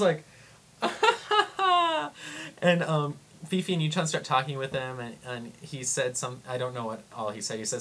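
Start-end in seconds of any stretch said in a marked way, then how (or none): 0.8-2.6 high, drawn-out awkward laugh; acted laugh of someone totally lost; high nervous "ha-ha-ha". Her own bewildered reaction, acted out.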